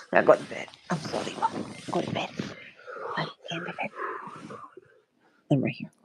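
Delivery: soft, hushed bedtime soothing. Real, not acted. Mother putting son to bed.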